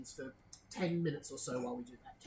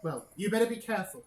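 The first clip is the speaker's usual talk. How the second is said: stern warning; 4 semitones higher, 5 dB louder than usual. Acted. Superior warns the lax commander.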